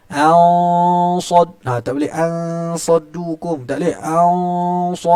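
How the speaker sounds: teacher modelling ansaddukum thick and full; thick nasal sound. Shown as a demonstration. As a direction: demonstrate ansaddukum with a heavy, thick ghunnah. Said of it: "tebal naik atas".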